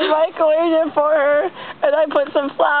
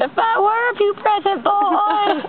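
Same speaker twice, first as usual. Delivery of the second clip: put-on higher, cracking teen boy voice; 7 semitones higher than usual. Joking about a voice in puberty, higher cracking boy voice. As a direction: squeak it out like a boy going through puberty. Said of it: "I sound like I need to go through puberty".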